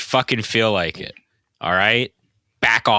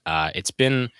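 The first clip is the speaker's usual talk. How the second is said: ordinary talk, nothing marked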